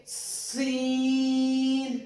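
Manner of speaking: سین stretched slowly with a hissing thin س; سین with a light whistling س; thin whistling Seen. Shown as a demonstration. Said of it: "سیٹی کی آواز نکلتی ہے".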